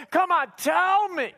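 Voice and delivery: high, drawn-out pleading; imitated whiny nagging; mock whining. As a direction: beg like a whining, nearly crying girlfriend. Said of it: "she's probably whining. She's probably crying".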